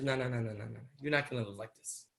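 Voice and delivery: low, soft dismissal; quiet retold refusal. Factory owner refusing to leave the family poor, quietly. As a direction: wave it away in a low undertone.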